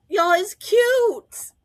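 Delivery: loud, high, drawn-out gush. Real, not acted. Vlogger gushing to viewers over a cute scene, gushing. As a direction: squeal it slowly with delight.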